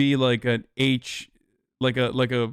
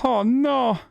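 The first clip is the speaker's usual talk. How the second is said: high, mock-Italian exclamation; Italian immigrant accent; 9 semitones higher than usual. Character reacting in a high Italian immigrant accent. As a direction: cry it out as a hammed-up Italian.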